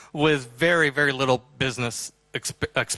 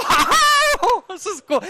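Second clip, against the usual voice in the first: much higher, louder whooping wow that echoes; shouting with joy; 5 dB louder, much slower than usual. Retold or imitated. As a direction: cheer it out together. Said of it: "all three of us are going".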